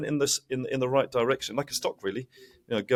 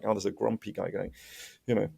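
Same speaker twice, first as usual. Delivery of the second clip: usual speaking voice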